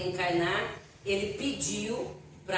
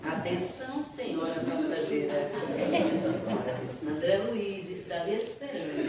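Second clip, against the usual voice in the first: announcer voice; 3 semitones lower than usual. Retold or imitated. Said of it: "Alguém vem e fala".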